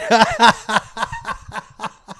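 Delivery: loud laugh falling off; real laugh. Real, not acted. Laughing at memory.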